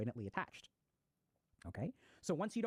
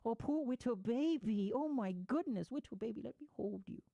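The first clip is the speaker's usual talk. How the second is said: put-on high cooing baby talk to a sad child; 4 semitones higher than usual. Parents cooing baby talk at their sad child. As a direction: coo over the kid like a doting parent. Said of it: "They're like".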